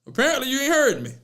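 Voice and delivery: high, exaggerated New Orleans accent; bad New Orleans accent. Host chiding silent producer, mock New Orleans accent. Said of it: "The worst New Orleans accent".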